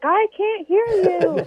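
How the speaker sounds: high, slow yell; retold yell. Co-driver yelling over earplugs, high and slow. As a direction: shout it slowly over the noise. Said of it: "he's screaming back at me".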